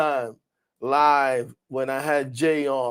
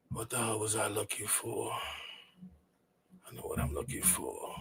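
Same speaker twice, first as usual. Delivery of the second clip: much softer, mumbled under the breath; muttered to self; 5 semitones lower, 17 dB quieter than usual. Real, not acted. Streamer searching for a file, muttering under the breath. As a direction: mutter to yourself while searching.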